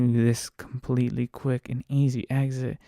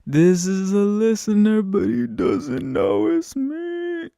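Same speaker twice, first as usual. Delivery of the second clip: much higher, louder, slow and thrilled; giddy excitement; 10 semitones higher, 4 dB louder, slower than usual. Retold or imitated. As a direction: squeal it like a fan discovery.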